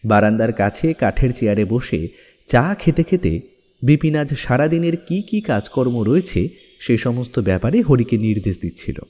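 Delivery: usual speaking voice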